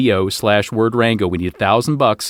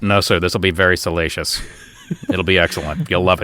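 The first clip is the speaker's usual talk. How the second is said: lower mock waiter voice; 6 semitones lower than usual. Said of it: "And I go".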